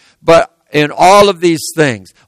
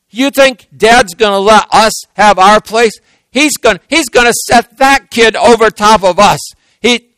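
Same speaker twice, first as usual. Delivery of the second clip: high, loud and indignant; resentful outburst; 7 semitones higher, 4 dB louder than usual. Acted. Brother griping to brothers about Dad's favorite, resentful. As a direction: protest like a jealous brother. Jealous brothers plotting against Joseph.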